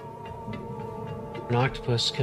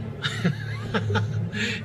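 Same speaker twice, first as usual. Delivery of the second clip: delighted laugh; 8 semitones higher than usual. Real, not acted. Delighted after spotting octopus.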